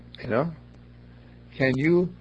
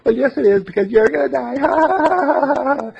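drawn-out, higher, louder taunt; a jester crowing that she's gonna die, slow and louder; mock jester; 11 semitones higher, 8 dB louder, slower than usual. Jester teasing the lady, hammed up high and loud.

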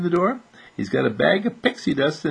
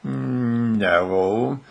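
hem, then refuse shyly; reluctant boy. Acted. Arnold reluctant to share.

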